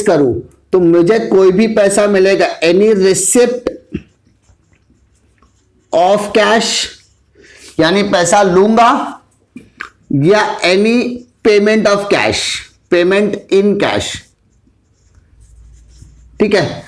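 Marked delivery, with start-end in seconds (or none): 2.6-14.0 slow dictation. Shown as a demonstration. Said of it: "teacher dictating terms slowly".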